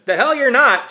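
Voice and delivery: put-on high, loud old woman's holler; furious holler at the kids. A narrator's reading. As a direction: bellow it back at the kid. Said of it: "she hollered".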